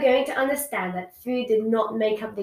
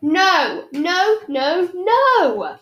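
panicked protest; 7 semitones higher, 7 dB louder, much slower than usual. Acted. Sister panicking about school closures.

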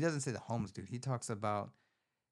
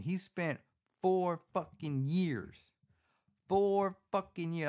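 four punched higher and louder; stress on four; 7 semitones higher, 5 dB louder, slower than usual. Real, not acted. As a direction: hit four hard with disbelief. Outraged emphasis while recapping plot.

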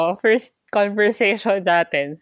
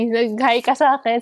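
ordinary delivery

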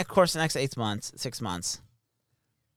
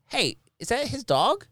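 high, slow put-on puzzled voice; puzzled soldier; 11 semitones higher, slower than usual. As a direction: ask it like a baffled onlooker. Soldiers quoted asking about dog.

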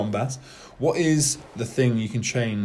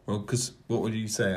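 ordinary talk

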